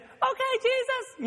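squeaky high little-man voice; tiny man voice. Retold or imitated. Zacchaeus answering Jesus from the tree, squeaky and very high. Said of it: "And Zacchaeus said".

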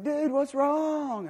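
slow, high-pitched stretched hippie drawl; laid-back hippie dude. Retold or imitated. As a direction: imitate a mellow hippie, dragging the words out. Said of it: "that laid-back hippie dude".

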